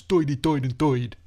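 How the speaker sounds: put-on old New York "Doidy Doid and Doid"; New York accent. Shown as a demonstration. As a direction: turn every "ir" into "oy", New York style. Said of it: "but in New York it becomes".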